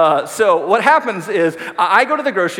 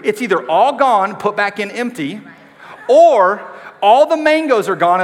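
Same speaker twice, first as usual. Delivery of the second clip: exasperated outburst; 5 semitones higher, 7 dB louder than usual. Real, not acted. Discovering fruit gone.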